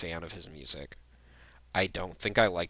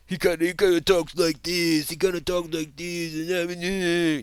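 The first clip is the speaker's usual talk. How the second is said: much higher put-on voice for the mocking impression; imitating Designer's voice; 10 semitones higher, 8 dB louder than usual. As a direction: squeak it out like you're mocking how he talks. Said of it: "That's how he talks".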